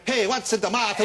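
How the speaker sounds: loud, high hammed-up Italian; mock a terrible Italian priest on set; bad Italian accent. Host teasing actor with a loud fake Italian accent. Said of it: "is your Italian accent so bloody awful".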